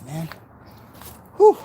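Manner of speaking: ordinary talk, nothing marked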